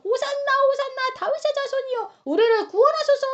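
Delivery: high chanting cry, swelling at the plea; crowd acclamation. Acted. Crowds hailing Jesus in the temple, high crying acclamation. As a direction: call out in praise like a crowd. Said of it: "무리들이 예수님을 향해서 막".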